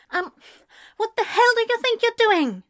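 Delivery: very high, loud indignant outburst; shrill demand. A narrator's reading. Girlfriend confronting Anthony for hugging his friend, shrill demand. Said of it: "at Clara's shrill demand".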